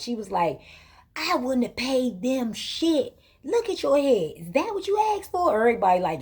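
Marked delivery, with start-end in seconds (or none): 1.1-4.3 high, rising disbelief, mocking the bad braids; incredulous big sister. Sister mocks the hair. 4.4-5.6 very high disbelieving question; mocking high question; incredulous squeal. Sister scoffs at hair.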